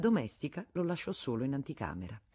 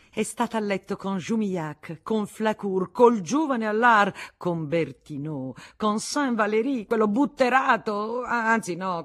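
louder and higher, building rage; furious tirade; 4 semitones higher, 7 dB louder than usual. A narrator's reading. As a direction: pile up the names in fury. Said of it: "la sua collera fece passare tumultuosamente". (sfogo di rabbia crescente, sempre più forte)

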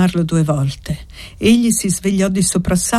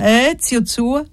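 called out; 6 semitones higher, slower than usual. A narrator's reading. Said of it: "gridò poi piegandosi sul letto".

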